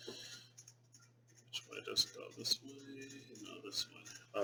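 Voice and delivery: very soft, half-whispered muttering; whispered self-talk. Real, not acted. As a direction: whisper to yourself while working it out. Muttering to self while placing cards.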